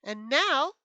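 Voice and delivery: ordinary delivery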